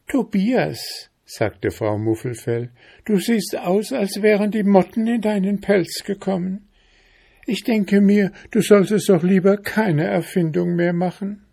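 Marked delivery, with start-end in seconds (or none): 0.1-1.1 very high voiced wife, falling tune; bear's wife. A narrator's reading. Bear's wife calling her husband by name, high-voiced address. 3.0-11.4 very high-pitched bear wife; chiding wife. A narrator's reading. Bear's wife advising her battered husband, high-voiced chiding.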